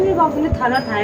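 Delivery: mimicked cutesy lisp; mock a couple's lisped sweet-talk; teasing baby-talk imitation; lovers' baby talk. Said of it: "लोग प्यार में यही बोलते हैं".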